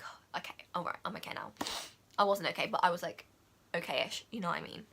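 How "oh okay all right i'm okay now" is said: soft whispered relief; relieved whisper. Retold or imitated. Vlogger recalling relief after the rescue, whispered. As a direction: breathe it out, calming yourself.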